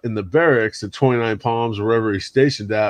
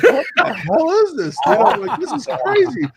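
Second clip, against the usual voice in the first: high mock disbelief; imitating his own baffled self; amazed. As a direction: squeak it out in disbelief.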